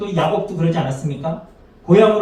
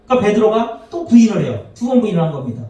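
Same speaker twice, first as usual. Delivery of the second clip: normal talking voice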